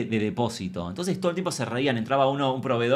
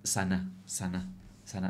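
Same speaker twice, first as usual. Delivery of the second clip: slow, soft mock-healing chant; mock soothing; 5 semitones lower, 9 dB quieter, slower than usual. Comedian playing victim, soothing the angry boss slowly. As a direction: coo it like a condescending healer. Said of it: "yo muy víctima".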